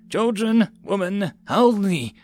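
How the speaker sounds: high, dread-filled listing; horrified, each word heavy; voice full of dread. A narrator's reading. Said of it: "in a voice full of dread".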